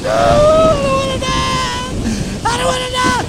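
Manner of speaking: very high, slow, hammed-up wail; mock crying. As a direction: whimper it like a scared child. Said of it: "he's crying".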